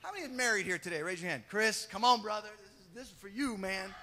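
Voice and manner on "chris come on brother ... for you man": usual speaking voice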